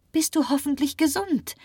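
very high, hopeful cry; exclaiming. A narrator's reading. Marie answers for the doctor, exclaiming hopefully. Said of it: "rief Marie aus".

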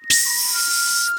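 startled, breathy hush; frightened whisper. Acted. Squirrel hushes the hare, whispering in fright. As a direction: hush them quickly, scared. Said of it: "flüsterte das erschrocken".

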